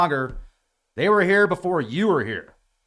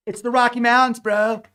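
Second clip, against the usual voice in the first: high, loud mock bro exclamation; 7 semitones higher, 6 dB louder than usual. Explaining the dry mouth to listeners, mock excited bro.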